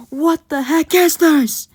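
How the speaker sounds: high, loud and slow disbelief; stunned at what he reads; drawn-out shocked cry; shocked outburst. Retold or imitated. Batman shocked at file.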